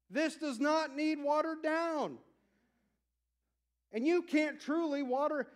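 rising to a fiery sermon climax; full-force preaching. Acted. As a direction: preach it hard to the rafters.

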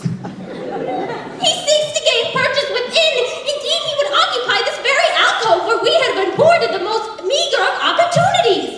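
high, loud pompous alarm; alarmed pig. Acted. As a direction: explain the threat in theatrical panic. Pigs alarmed about the wolf.

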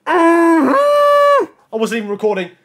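high, slow, drawn-out wail; anguished outburst. Real, not acted. Gamer who missed recording his big moment, anguished outburst. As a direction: wail it out, stretching the words in dismay.

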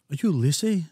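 English local's question, badly imitated; attempted English accent. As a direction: put on an unpractised English accent. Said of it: "excuse my accent. I don't know how to do that accent".

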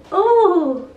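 high, loud gasp of surprise; surprised yelp at the cold. Real, not acted. Vlogger startled by cold air, loud high yelp. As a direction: jolt at something cold.

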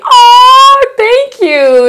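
real touched gush, voice breaking high and loud; overwhelmed thank-you to a fan; emotional gush. Real, not acted. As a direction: let it spill out, near tears. Overwhelmed by a sweet comment.

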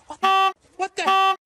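loud high disbelief; shocked shout. Real, not acted. Shock at the destruction.

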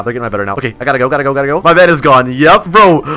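ordinary delivery

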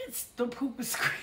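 usual speaking voice

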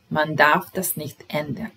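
ordinary talk, nothing marked

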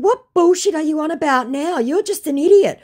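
high, scornful retort; exasperated. Retold or imitated. Scolding the scammer, high and scornful. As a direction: fire back in disbelief.

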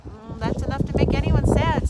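loud sympathetic sigh at the start. A narrator's reading. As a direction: sigh in mild sadness. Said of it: "sighed Drufus".

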